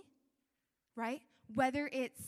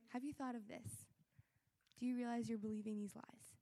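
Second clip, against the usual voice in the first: soft, lowered, tender questioning; gentle; 2 semitones lower, 7 dB quieter than usual. Acted. God corrects a loved child, so gently. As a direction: nudge them kindly and quietly. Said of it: "He'll so gently say".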